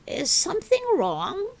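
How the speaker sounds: a hint of a concerned older lady asking; gentle older lady. A narrator's reading. Missus Willow voiced in story.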